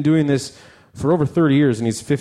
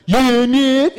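high caricatured reply; bandmate answering; 9 semitones higher, 4 dB louder than usual. Acted. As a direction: answer back in a silly high voice. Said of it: "And the Betsy goes".

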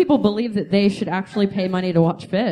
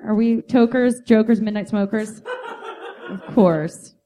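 ordinary talk

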